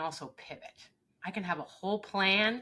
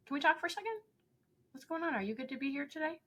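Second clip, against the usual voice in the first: higher, gentle concerned questions; caring check-in; 10 semitones higher, faster than usual. Retold or imitated. Coach checks on upset person.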